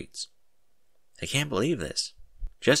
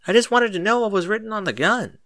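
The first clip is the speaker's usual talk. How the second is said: put-on high, whiny pout of a kid; 12 semitones higher, 4 dB louder than usual. A narrator's reading. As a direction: sulk it out, pitched up. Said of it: "I pout".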